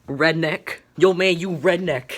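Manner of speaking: mock insult call. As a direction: taunt everyone like the loud new kid. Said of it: "he would call everyone a redneck".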